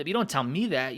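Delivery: usual speaking voice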